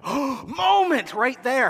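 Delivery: slow, high, loud astonishment; shocked gasp. Retold or imitated. Audience reacting to Vader's reveal, high astonished gasp. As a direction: gasp then marvel.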